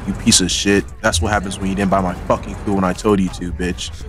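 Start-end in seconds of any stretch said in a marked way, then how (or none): none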